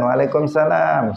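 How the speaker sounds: everyday careless reply; habitual reply. Retold or imitated. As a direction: toss off "Waalaikumsalam" out of habit. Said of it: "Dia mengatakan".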